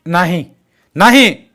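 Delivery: shouted refusal. A narrator's reading. Jaydeep forbids lifting the body, shouting. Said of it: "Jaydeep ओरडला".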